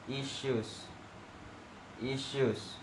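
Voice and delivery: "issues" with a clear sh, not s; sh sound. Shown as a demonstration. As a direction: shape the sh in "issues". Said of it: "It should sound like".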